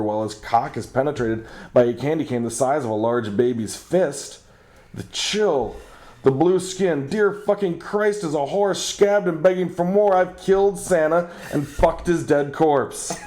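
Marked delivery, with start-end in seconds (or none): 4.9-13.2 rising shriek of mock dread as it sinks in; mock horror. Realizing he killed Santa, hammed-up horrified rising voice. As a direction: pitch climbing into hammed-up panic.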